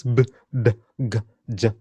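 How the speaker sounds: b, d, g, j as short emphatic sounds; b, d, g, j each voiced hard, separately; hard consonants. Shown as a demonstration. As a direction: sound out b, d, g, j with a bit of force. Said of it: "Put a bit of emphasis on those".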